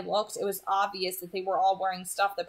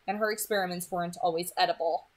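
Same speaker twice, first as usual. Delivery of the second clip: plain reading voice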